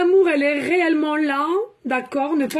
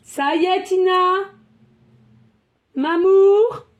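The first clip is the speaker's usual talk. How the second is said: high, loud sing-out to a pet; calling the dog; 5 semitones higher, 6 dB louder, slower than usual. Real, not acted. Owner calling her protective dog Tina, high calling. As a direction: call the pet name out, rising and drawn out.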